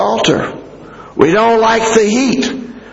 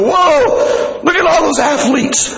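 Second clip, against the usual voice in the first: higher-pitched mock awe; impressed spectator; 5 semitones higher than usual. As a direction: play a wowed fan watching athletes. Acting impressed Olympics viewers.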